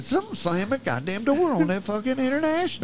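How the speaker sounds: high-pitched insistent outburst; retold alarm. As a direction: protest it in mock panic. Retold alarmed answer to friend.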